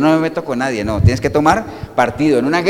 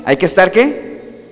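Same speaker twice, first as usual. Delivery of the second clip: usual speaking voice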